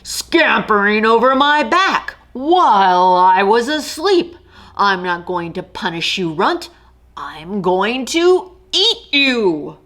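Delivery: a sleepy king of beasts who means to eat; menacing lion. Acted. Lion voiced in story.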